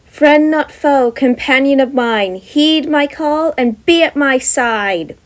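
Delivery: slow, loud solemn chant; spell incantation. A narrator's reading. Jem reciting a spell to summon her familiar, slow solemn chant. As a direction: intone the summoning spell slowly.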